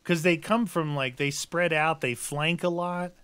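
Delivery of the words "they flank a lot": usual speaking voice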